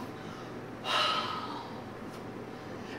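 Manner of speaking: long, soft, exaggerated exhale; wolf's huff. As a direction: blow out a big breath as the wolf. Wolf huffs.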